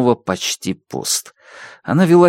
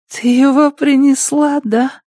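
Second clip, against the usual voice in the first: high, eager addict voice; light up at the gift, voice up high; excited junkie. Acted.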